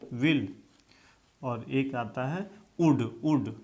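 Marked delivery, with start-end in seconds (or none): 2.8-3.5 would said as वुड, nearly "ood"; would with silent L. Shown as a demonstration. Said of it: "लेकिन उच्चारण यही है". (would को वुड बोलें, L चुप)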